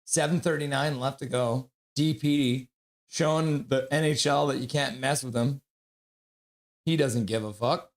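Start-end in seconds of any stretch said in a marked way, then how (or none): none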